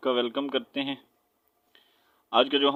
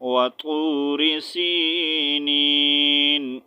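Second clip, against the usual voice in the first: slow melodic tajweed recitation; Quran recitation; 4 dB louder, much slower than usual. Shown as a demonstration. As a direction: draw out سِينِينَ and stop. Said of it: "next". (آہستہ ترتیل سے تلاوت)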